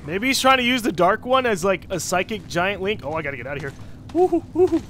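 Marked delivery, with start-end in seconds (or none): 4.1-4.8 excited cheer. Real, not acted. Cheering while escaping.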